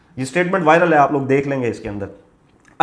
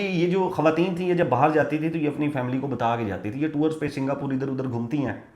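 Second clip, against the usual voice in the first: usual speaking voice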